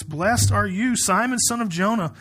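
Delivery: brisker, lifted proclamation of a blessing; scripture reading. Acted. Jesus blessing Simon, read out as a clear proclamation. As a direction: proclaim the blessing and keep moving.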